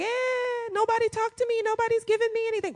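high, whiny churchgoer voice; mock complaining. Preacher mimicking a neglected churchgoer, high mock whine. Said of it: "acting like".

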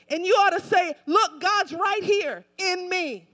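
loud, driving sermon delivery; impassioned preacher in full flow; full-force preaching. Real, not acted. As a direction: pound out each phrase to rouse the congregation.

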